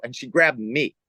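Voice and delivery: ordinary talk, nothing marked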